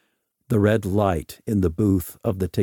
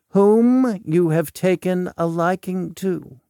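Volk, knowing; 9 semitones higher, 4 dB louder than usual. Acted. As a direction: finish his sentence for him, pitched up.

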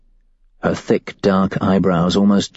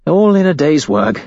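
louder, brightly breezy cover for worry; hammed-up cheerful shrug; forced lightheartedness; 9 semitones higher, 4 dB louder than usual. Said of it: "I say with forced lightheartedness".

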